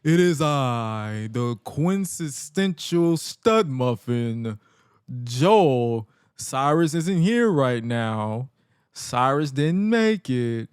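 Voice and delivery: slow, drawn-out pompous ring introduction; imitated self-important announcer, very slow; Joel Gertner. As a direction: stretch each phrase out like a grandiose wrestling blowhard. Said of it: "[name] introduces himself and, and then".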